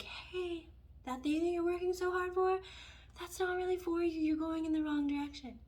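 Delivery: high, sweet kindly voice; gentle universe. Pretend. The universe gently redirects a struggling person, high and sweet. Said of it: "the universe would be like".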